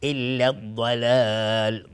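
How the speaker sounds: slow chant with الضلال given a thick, heavy ضاد; heavy daad. Shown as a demonstration. Tajwid teacher showing students a heavy letter. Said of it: "دقت کنید".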